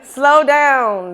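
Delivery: excited, loud slow down; telling herself to slow down. Retold or imitated. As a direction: call out to yourself over loud music. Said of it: "I have to say".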